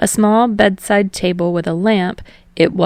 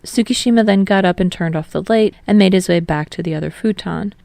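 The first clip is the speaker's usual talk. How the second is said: plain reading voice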